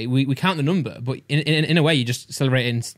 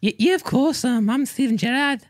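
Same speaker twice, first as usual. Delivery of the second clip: high, slow Scouse footballer voice; hammed-up, squeaky Liverpool accent; Steven Gerrard; 9 semitones higher than usual. Shown as a demonstration. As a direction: put on high-pitched Scouse. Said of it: "Do, do Scouse".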